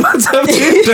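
quoting through a laugh; laughing quote. Retold or imitated. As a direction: giggle the greeting back. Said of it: "when you said".

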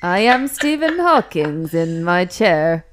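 loud, slow mock computer voice; Stephen Hawking. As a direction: drag it out like a bad speech synthesiser. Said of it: "I sound a bit like Stephen Hawkins".

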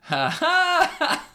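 high hammed-up fake laugh; mock laugh. Comic mimicking a heckler's laugh in the crowd. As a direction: imitate a loud fake audience laugh. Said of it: "and then I hear".

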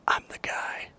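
soft unvoiced confiding whisper; whispered reveal. Jesus quietly revealing himself to Nathanael, whispering. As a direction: breathe it like a secret.

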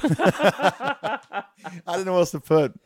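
high, laughing through words. Real, not acted. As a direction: laugh as you say it. Laughing at own text.

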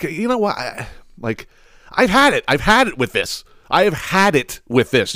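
high, rising fed-up rant; fed-up outburst. Retold or imitated. Boy refusing another move, high exasperated outburst. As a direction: throw up your hands, done with it. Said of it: "I'm like".

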